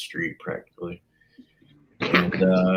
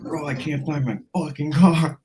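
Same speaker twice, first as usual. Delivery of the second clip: higher, panicked friend imitation; retold freak-out; 5 semitones higher than usual. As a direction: imitate a buddy starting to panic. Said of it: "So he's freaking out".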